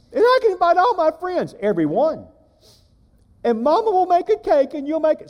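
put-on high, eager childlike voice; a small boy getting excited about his party; little boy voice. Boy tells his daddy about the party, high childlike voice.

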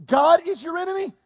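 high-pitched mock disbelief; incredulous question. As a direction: question it as if it's absurd. Samuel incredulous at Saul's request.

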